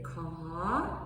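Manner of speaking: "ka" low and rising. Shown as a demonstration. Teacher showing group two: rising "ka". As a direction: let "ka" climb. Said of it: "Group two, they're gonna say".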